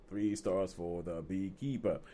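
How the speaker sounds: low, slow and soft repeat; softly drawn-out sign-off; hushed echo. Real, not acted. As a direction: echo the verdict quietly, drawing it out. Echoes own announced rating, softly.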